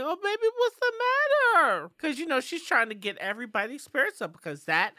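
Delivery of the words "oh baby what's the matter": put-on high-pitched, babying concern; mock concerned cooing. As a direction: coo it like fussing over a sad baby. Voicing Fernanda cheering up Pam.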